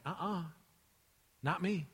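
soft, short refusal; hushed retold objection; quiet refusal. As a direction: refuse under your breath as Peter. Peter refuses the foot washing.

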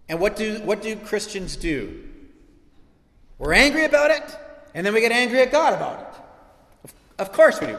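forceful, high-pitched sermon delivery; impassioned preaching. Real, not acted. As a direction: drive the point home to the congregation.

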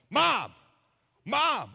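high, loud panicked shouts; yelling for mom. Retold or imitated. Half-asleep husband yelling for his mom in the night. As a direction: yell for your mom like you just woke from a nightmare. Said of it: "And I start yelling".

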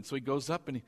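ordinary delivery

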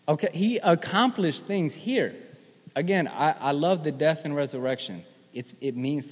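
high, loud, rising preacher's fervour; preaching at full force. Acted. Preacher urging his congregation that this is real, fired up. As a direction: preach it out, pushing toward the congregation.

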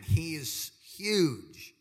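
huge drawn out very slow, each word leaned on; slow emphatic he is huge; my emphasis. Shown as a demonstration. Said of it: "That's my emphasis".

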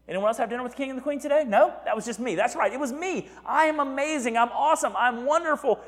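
high, fast mock boasting; boastful bragging. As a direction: crow about yourself like a show-off. Haman brags to his friends.

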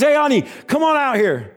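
high-pitched call to someone in another room. Acted. Cook calls to his wife in the kitchen, calling out. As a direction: call her out here, voice lifted.

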